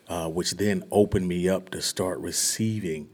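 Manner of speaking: ordinary talk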